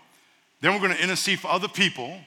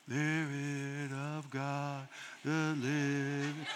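sleepy churchgoer; 4 semitones lower, 13 dB quieter, much slower than usual. Retold or imitated. Mocking a sleepy church singer.

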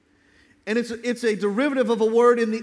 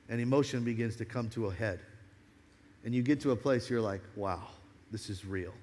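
low, soft, reflective preacher; hushed, solemn preaching; 8 semitones lower, 8 dB quieter than usual. Real, not acted. Preacher describing tears after a painful breakthrough, hushed and solemn. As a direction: drop down low and soft for a weighty moment.

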